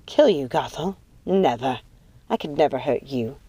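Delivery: low, hushed motherly voice; tender reassurance. A narrator's reading. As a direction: soften and drop low to reassure. Mother tenderly reassures favoured daughter.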